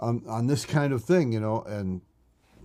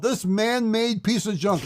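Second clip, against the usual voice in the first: high-pitched, drawn-out exasperated mock yell; retold yell; 8 semitones higher, slower than usual. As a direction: act out yelling at a computer, pitch raised. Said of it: "I would yell out".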